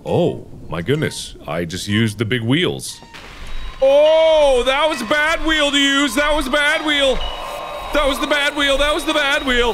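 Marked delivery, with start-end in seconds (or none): 3.8-9.7 high, rapid-fire repeated alarm; panicked squeal. Real, not acted. Player regretting the bad wheel, high panicked babble. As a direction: squeal it over and over in rising panic.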